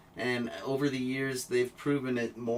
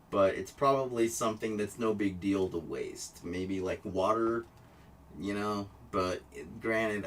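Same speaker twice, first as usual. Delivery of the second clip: usual speaking voice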